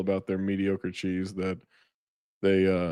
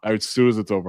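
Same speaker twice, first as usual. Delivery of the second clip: ordinary talk, nothing marked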